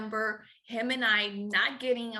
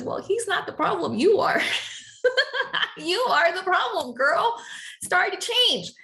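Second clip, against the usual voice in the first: loud, higher, firm telling-off; God's firm reply; 8 semitones higher, 6 dB louder than usual. Retold or imitated. God telling her in prayer she is the problem, loud and firm. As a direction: scold her kindly but loudly. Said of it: "he clearly says".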